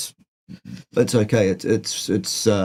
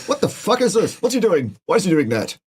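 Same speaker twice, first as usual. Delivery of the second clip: baffled; 7 semitones higher than usual. Retold or imitated. Mimicking baffled onlookers.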